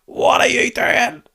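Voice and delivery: high, slow, drawn-out shout; incredulous fan yell. Retold or imitated. Fan yelling at a player after a goal, high stretched shout. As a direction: holler at the player in disbelief. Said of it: "hearing somebody going".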